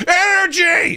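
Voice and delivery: high, bright burst of "Energy!"; energized wake-up. Retold or imitated. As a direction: pop awake full of pep. Waking from a nap, energized.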